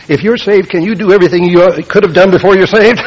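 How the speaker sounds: high, loud acted questioning; loud challenge. Questioner at the church door challenging Christians, loud and high.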